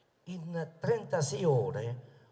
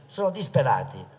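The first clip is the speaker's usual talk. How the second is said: normal talking voice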